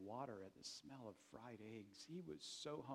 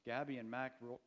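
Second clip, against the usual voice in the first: usual speaking voice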